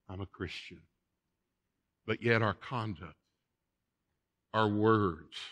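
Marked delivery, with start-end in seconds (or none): 0.1-0.8 very soft, small imitated reply; acted meek answer. Believer answering God's question, meekly and very softly. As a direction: answer under your breath.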